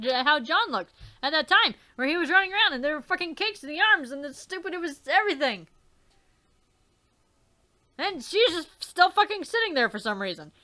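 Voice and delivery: ordinary talk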